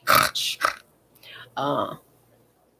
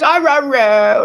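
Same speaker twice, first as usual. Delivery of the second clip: slow, loud, high dog-talk noises; imitating dog sounds; 6 semitones higher, 7 dB louder, much slower than usual. Owner mimicking her dog's chatty noises, slow and loud. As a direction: drag out the dog's rah rah. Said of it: "She says".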